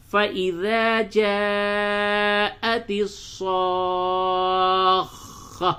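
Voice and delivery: very slow, drawn-out chanted recitation; Quran recitation. Acted. Teacher recites the verse slowly in class. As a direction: chant each word slowly, holding the vowels.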